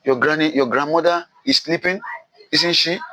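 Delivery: put-on high-pitched, innocent boy's question; a child's guileless remark, voiced high. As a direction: ask naively, pitch lifted well up. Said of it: "innocently says".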